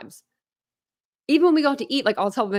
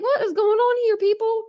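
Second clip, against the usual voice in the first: high, exasperated plea to everyone; exasperated outburst; 13 semitones higher than usual. Retold or imitated. She imitates herself fed up with people, high and exasperated. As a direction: throw up your hands at the crowd. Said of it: "I'm just like".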